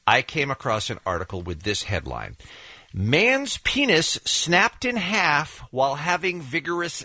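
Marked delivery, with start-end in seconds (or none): 2.9-7.0 slow dramatic reading. Acted. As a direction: read it slow and deliberate. Reading shocking headline aloud.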